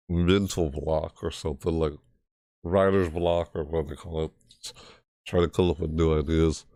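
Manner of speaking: ordinary delivery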